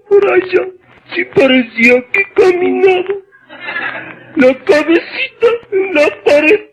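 high, slow hammed-up sobbing; mock wailing lament. Neighbor tells neighbor of husband's death, mock crying. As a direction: wail it like a grieving widow, overdone.

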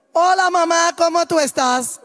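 a hint of the careless son calling home; son's voice. Son calling his worried mother, breezy and carefree.

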